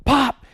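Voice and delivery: high, loud, sudden pop; imitated pop. Actor snaps the bead chain, sudden imitated pop. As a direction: punch out a popping sound. Acts out the beads snapping.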